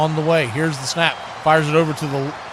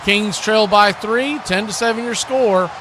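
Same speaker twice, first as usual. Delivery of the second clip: radio break announcement; 6 semitones higher, 3 dB louder than usual. Real, not acted. Going to commercial break.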